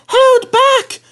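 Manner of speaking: very high squeaky held back; high conspiratorial squeak; whispered coach voice. A narrator's reading. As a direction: pass the secret like a giggling coach. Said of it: "whispered Lucy to Beatrice".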